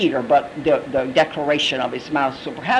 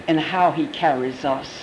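usual speaking voice

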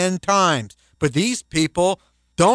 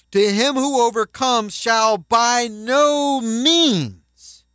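slow, higher, stressed NO; preacher stressing "by no means" slowly and higher; emphatic; 6 semitones higher, slower than usual. Acted. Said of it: "to make a sentence emphatic".

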